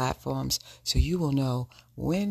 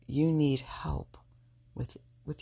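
whispering; 2 semitones higher than usual. Real, not acted. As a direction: breathe the words out. Intimate advice.

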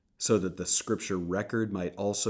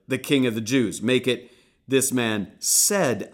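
raised, higher demand, slowing on the fix; loud objection; 5 semitones higher, 9 dB louder than usual. Acted. Priests demanding Pilate change the sign, raised voice. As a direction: insist on the new wording.

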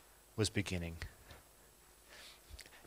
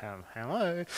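a shy, softer little hello; timid Ruth; 6 dB quieter than usual. Retold or imitated. Ruth greeting Naomi's people from the background, shy and soft. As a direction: hover in the background, unsure of your welcome. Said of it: "being like".

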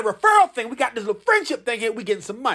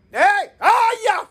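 a high, loud hype shout; excited whoop; 10 semitones higher, 3 dB louder than usual. Acted. Hyping listeners about free Cash App money, loud excited whoop. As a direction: whoop it up like you just found cash.

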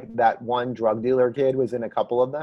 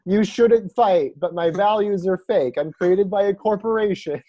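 mock PSA hero; 5 semitones higher than usual. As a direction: sell corporate values with a straight face that cracks.

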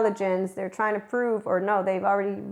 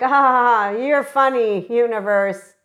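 loud fake laugh at the universe; ha-ha it out loud, sarcastically amused; hammed-up sarcastic laugh aimed at the universe; mock laugh; 3 semitones higher, 7 dB louder than usual. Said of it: "We're like".

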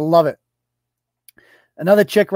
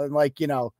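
ordinary talk

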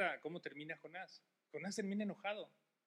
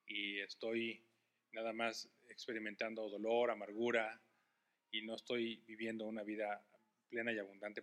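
closing prayer; 5 semitones lower, 15 dB louder than usual. Acted. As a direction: pray over the standing congregation. Pastor leads closing prayer.

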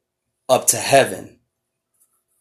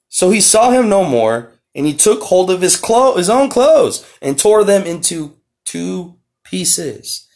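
slow, raised, solemn reading of scripture; 5 semitones higher, slower than usual. Acted. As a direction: read the verses out like a preacher, slowing down. Reads scripture aloud.

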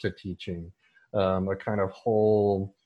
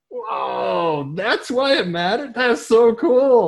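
rising much higher and louder into slow wonder; amazed discovery; 12 semitones higher, 7 dB louder, much slower than usual. Retold or imitated. Student realizing why meter matters, slow, high, amazed. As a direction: gasp it out like a sudden revelation. Student suddenly grasping poetry's point.

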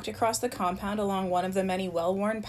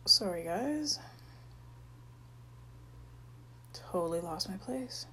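quiet aside; 11 dB quieter than usual. Real, not acted. Reader apologises, lost the page.

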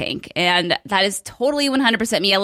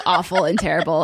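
ordinary talk

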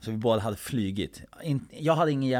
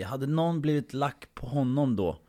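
ordinary talk, nothing marked

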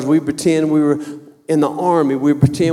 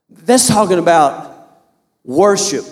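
normal talking voice

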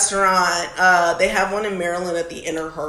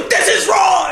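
high, loud mock shout; hammed-up chef yelling at a customer; Gordon Ramsay screaming; 11 semitones higher, 5 dB louder than usual. Fake Ramsay yelling at a diner midway through cooking, mock screaming. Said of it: "just, like, screaming".

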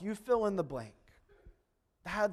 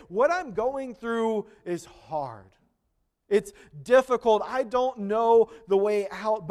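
preaching declaration; 4 semitones higher, 8 dB louder than usual. Acted. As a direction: proclaim it with growing conviction. Voiced declaration of faith.

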